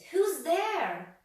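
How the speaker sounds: high, loud nervous call; frightened call. A narrator's reading. Mister Jelly calling out, high and scared. Startled character calls out.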